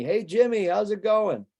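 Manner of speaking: high, cheery hey to a friend; bright hail of a gas station regular; guy greeting a buddy. Retold or imitated. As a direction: call out to an old pal in line. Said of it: "Tony says to Jimmy".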